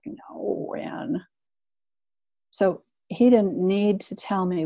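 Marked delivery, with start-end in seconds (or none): 0.0-1.2 quiet, near-whispered no with a laugh behind it; hushed, amused denial; soft amused reply. Retold or imitated. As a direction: answer gently, almost under the breath. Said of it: "He laughed. He's like".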